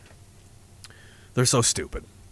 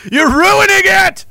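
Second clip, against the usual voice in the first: exasperated plea; 12 semitones higher, slower than usual. Retold or imitated. Telling a washed-up boxer to stop fighting, high slow exasperated plea. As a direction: beg them to stop wrecking it.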